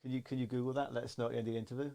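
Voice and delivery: hushed aside to a pretend off-mic assistant; pretend assistant request. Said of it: "There's no one there. I just wanted to feel important".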